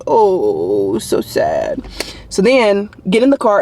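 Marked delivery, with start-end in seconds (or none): 0.1-1.8 low, drawn-out mock pity; mock sad. Owner describing her scolded dog's ears, mock-sad. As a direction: let it sink into a low, pitiful droop.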